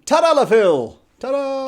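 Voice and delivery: loud high magician reveal; ta-da announcement. Pretend. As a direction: proclaim it like a magic trick. Playful ta-da reveal.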